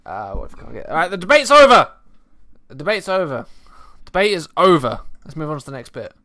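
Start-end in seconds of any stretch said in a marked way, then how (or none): 0.9-5.0 loud, firm cut-off; loud call. Real, not acted. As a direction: shout that the debate's over. Ending the banter, calling out.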